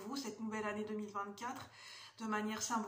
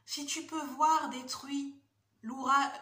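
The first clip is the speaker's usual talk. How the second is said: louder, measured poetry reading; poem recitation; 4 semitones higher, 8 dB louder, slower than usual. Acted. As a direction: declaim the opening of a solemn poem. Reads a poem aloud.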